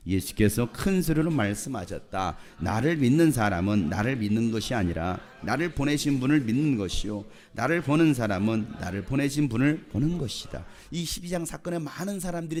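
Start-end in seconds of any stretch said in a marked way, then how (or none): 0.1-10.7 loud, measured congregational reading; scripture reading. Real, not acted. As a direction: read out firmly for all to follow.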